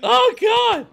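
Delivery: startled cry. Real, not acted. Attacked by Tom.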